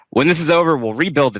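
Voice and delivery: mock crowd scream, high and loud. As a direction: yell it like a panicked villager. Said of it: "they scream".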